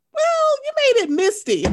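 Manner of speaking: high, loud teasing retort; mocking comeback. Host voicing Kenya's put-down, high and loud. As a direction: needle her with the put-down. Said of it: "Why can't you say".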